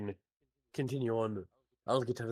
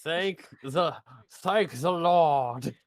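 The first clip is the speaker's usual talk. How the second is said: put-on a barely-there Irish accent, slow and louder; half-hearted Irish accent that hardly lands; bad Irish accent; 3 semitones higher, 7 dB louder, much slower than usual. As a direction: attempt a weak Irish lilt, drawn out. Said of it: "Is that your attempt at a bad Irish accent?".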